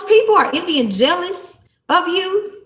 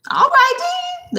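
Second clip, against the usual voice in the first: taunting drawl; slower than usual. Real, not acted. Taunting people who want stress.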